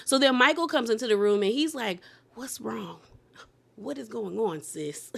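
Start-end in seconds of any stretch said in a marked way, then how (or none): 2.3-5.1 hushed worried whisper. Retold or imitated. Michael checking on his sister in a hushed whisper. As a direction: breathe it out, barely voiced.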